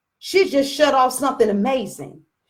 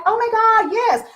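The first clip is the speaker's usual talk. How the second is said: mock gushing agreement; 8 semitones higher than usual. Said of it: "people that were like".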